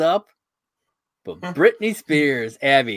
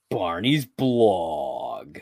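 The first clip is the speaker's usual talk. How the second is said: very drawn-out announcer title; segment announcer; 3 semitones lower, much slower than usual. Pretend. Announces the show's recurring segment.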